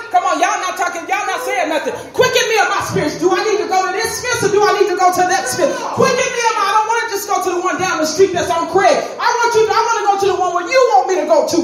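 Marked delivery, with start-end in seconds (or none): 2.1-11.6 quick, urgent plea to God; higher, driving church prayer; fervent preached prayer. Acted. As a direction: pour the prayer out in a rush. Preaches acted prayer at pace.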